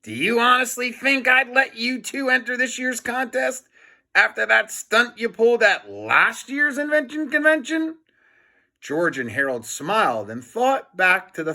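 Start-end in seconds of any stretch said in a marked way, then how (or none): 0.0-8.0 scornful principal. Acted. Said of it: "laughed Mister Krupp".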